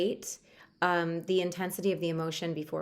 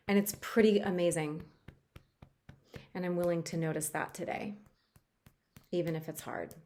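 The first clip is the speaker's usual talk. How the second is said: tapping script. Acted. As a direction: speak each line for the listener to repeat. Reads a tapping script aloud.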